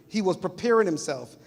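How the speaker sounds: ordinary talk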